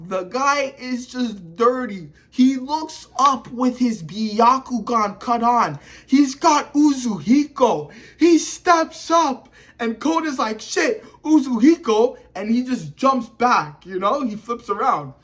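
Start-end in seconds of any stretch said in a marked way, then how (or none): 6.1-12.2 rising, hyped-up telling; excited. Real, not acted. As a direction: get pumped telling the big move. Hyped retelling of the attack.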